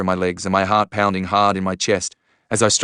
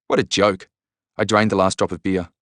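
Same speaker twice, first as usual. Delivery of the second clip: ordinary delivery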